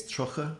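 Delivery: trucha said with a hard k sound; Munster trucha. Shown as a demonstration. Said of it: "so Munster here".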